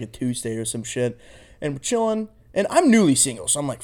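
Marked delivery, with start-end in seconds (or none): none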